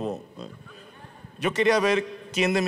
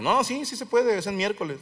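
higher-pitched eager insistence; insisting friend; 7 semitones higher than usual. Retold or imitated. Friend urging him to come to the reunion, insistent. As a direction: talk them into it, voice lifted.

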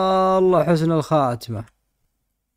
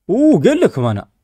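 loud, high excited exclaim; 6 semitones higher, 7 dB louder than usual. Real, not acted. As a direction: burst out in excitement. Excited reaction in game.

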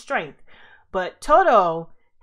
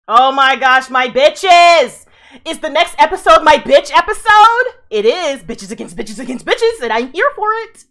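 loud, laughing cheers at the mic; excited yelling; 5 semitones higher, 11 dB louder than usual. Real, not acted. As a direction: shout with glee over the next episode. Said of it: "Sorry to yell in the mic".

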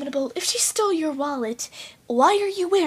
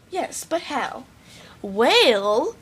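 normal talking voice

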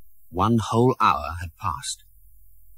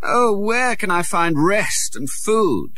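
put-on high, slow plea of a lost boy prince; a tired, hungry prince crying out for help; crying out wearily; 11 semitones higher, slower than usual. A narrator's reading. Lost prince begging for rest, slow high plea. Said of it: "he cried".

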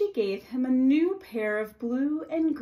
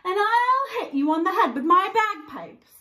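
higher, louder threat; fierce threat; 7 semitones higher, 5 dB louder than usual. Acted. Woman threatening pirate with bagpipes, loud. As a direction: shake the bagpipes at him. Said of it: "said the woman".

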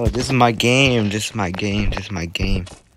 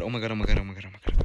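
usual speaking voice

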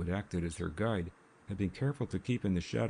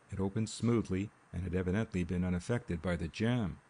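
usual speaking voice

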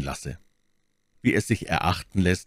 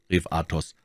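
ordinary delivery

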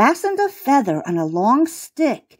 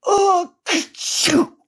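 high, slow build-up to achoo; drawn-out cartoon sneeze; acted sneeze; 10 semitones higher, slower than usual. A narrator's reading. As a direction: sneeze hugely like a gator with chalk dust. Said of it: "sneezed the gator".

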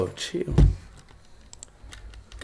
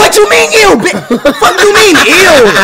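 mock outrage; 2 semitones higher, 8 dB louder than usual. Real, not acted.

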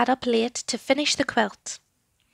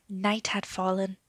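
plain reading voice

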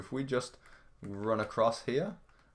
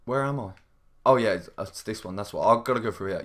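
ordinary talk, nothing marked

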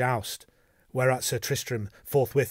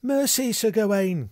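high, loud plea for mercy; pleading cry; 10 semitones higher, 6 dB louder than usual. A narrator's reading. Damsel begs the knight who threatens her, crying out. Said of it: "cried the damsel".

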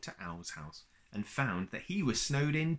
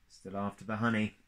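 ordinary delivery

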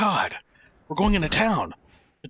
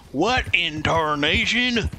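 cowboy accent; 2 semitones higher, much slower than usual. Shown as a demonstration. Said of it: "Repeat after me".

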